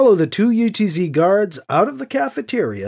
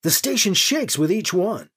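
ordinary delivery, nothing marked

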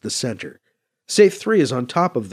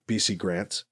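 ordinary delivery, nothing marked

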